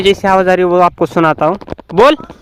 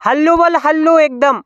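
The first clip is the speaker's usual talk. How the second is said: calling out; 9 semitones higher, 4 dB louder than usual. Real, not acted. Calling to helper far off.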